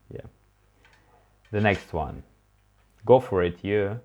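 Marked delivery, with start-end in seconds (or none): none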